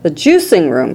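usual speaking voice

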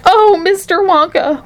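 slow, high, pleading wail; distraught mother, pitch way up. A narrator's reading. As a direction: wail for help, drawing it out. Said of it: "wailed Missus T V".

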